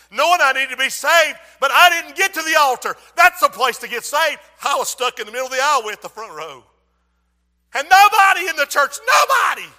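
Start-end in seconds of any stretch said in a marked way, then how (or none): 0.1-5.6 loud, rising testimony; full-force preaching. Real, not acted. Preacher recalling falling short of the altar, full-force preaching. 7.7-9.7 high, driving pulpit shout; full-force preaching. Real, not acted. Preacher urging the church about shattered plans, full-force preaching.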